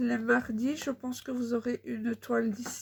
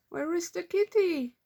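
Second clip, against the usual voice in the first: high, bright-eyed question to a little one; 7 semitones higher, 5 dB louder than usual. Real, not acted. Mother asks her toddler where the kitty is, sing-song.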